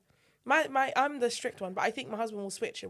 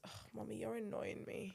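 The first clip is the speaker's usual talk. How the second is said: put-on whispered toddler complaint; 17 dB quieter than usual. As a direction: hush it like a sulky toddler. Toddler son quoted, whispered.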